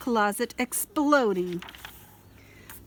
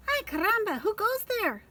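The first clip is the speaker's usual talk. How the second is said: Chihuahua character voice; 11 semitones higher than usual. A narrator's reading. Skippy voiced as excited Chihuahua.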